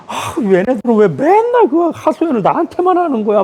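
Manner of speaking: high, exasperated grumble to herself; fed up, complaining on the way home; high pitched mock exasperation; grumbling complaint.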